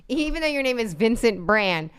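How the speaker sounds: normal talking voice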